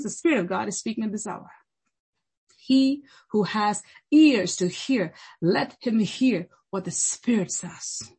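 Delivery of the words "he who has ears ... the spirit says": slow, weighty declaration; measured, deliberate preacher's pronouncement; solemn scripture. Real, not acted. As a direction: space out the words with gravity. Solemn closing scripture quotation.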